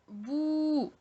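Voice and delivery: high, drawn-out bou; long vowel. Shown as a demonstration. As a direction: stretch out the ou in bou. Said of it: "la voyelle longue avec le son ou".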